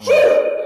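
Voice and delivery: very high, loud "woo"; imitated excited whoop. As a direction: mimic a thrilled boy's cheer. Son's excited cheer retold.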